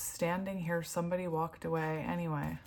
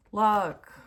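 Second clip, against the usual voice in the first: urgent; 3 semitones higher, 10 dB louder than usual. Real, not acted. Urging viewers to see.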